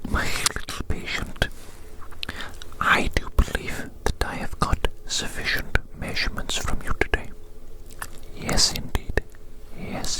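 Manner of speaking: ordinary talk, nothing marked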